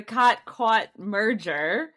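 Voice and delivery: first "caught" as "kaht", second "caught" as "kawt", drawn out; two distinct vowels. Shown as a demonstration. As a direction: slowly set "caught caught" apart, ah then aw. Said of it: "we say these words different".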